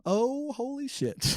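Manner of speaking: high, slow, drawn-out exclamation; catchphrase voice. Pretend. Host pitching the sign-off catchphrase, high drawn-out voice. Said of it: "Is that your pitch for the end?".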